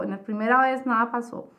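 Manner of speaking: ordinary talk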